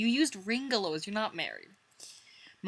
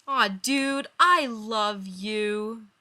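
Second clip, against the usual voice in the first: slow drawn-out tender line; tender Joey; 3 semitones higher, 8 dB louder, much slower than usual. Acted. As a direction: draw it out lovingly.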